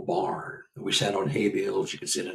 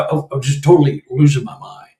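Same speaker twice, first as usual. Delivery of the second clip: ordinary talk, nothing marked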